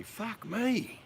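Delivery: high-pitched, amazed outburst; excited exclamation. Acted. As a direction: exclaim it like a sudden lottery win. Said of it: "exciting happened".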